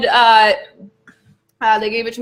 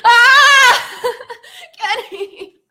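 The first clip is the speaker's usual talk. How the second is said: laughing pained cry; 5 dB louder, much slower than usual. Real, not acted. Burning hot food reaction.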